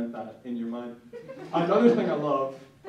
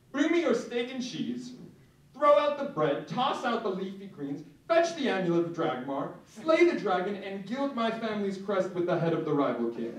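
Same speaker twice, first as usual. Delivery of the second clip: pompous king; 5 semitones higher than usual. Pretend. Diner commanding his waiter like a pompous king.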